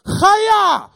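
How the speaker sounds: "Chaya!" bellowed high and loud, a big rasp on the ch; throat-clearing battle cry; shouted Chaya. Shown as a demonstration. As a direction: blast out "Chaya!" like a karate cry. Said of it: "here is how you pronounce that word".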